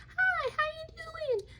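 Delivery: very high, slow squeaky food voice; cartoon food voice greeting the kids; broccoli voice. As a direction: talk like a tiny broccoli character. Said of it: "give them little voices".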